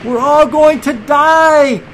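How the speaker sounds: high, loud cry of terror; panicked disciple. A narrator's reading. Disciple to crew as the boat nearly sinks, panicked shout. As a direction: wail it slowly over the storm. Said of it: "one of them said".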